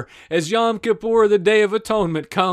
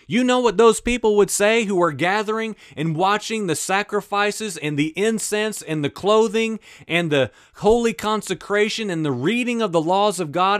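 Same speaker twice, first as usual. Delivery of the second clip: ordinary delivery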